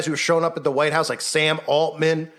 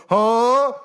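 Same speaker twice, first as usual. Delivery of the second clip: sharp, higher, louder huh of disbelief; mock baffled huh; 5 semitones higher, 4 dB louder than usual. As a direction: feign total confusion. Mock confusion at Golden Dome claim.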